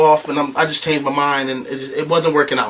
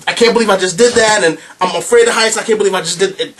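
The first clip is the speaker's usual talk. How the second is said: excited yelling; 5 semitones higher than usual. Retold or imitated. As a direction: yell it in disbelief and joy. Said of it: "I'm, like, screaming and yelling".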